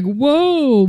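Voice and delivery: high-pitched mind-blown whoa; imitated amazement. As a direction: play an impressed onlooker. Imitating people's blown minds.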